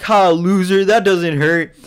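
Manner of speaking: high, loud mocking taunt. Taunting the kid punching him.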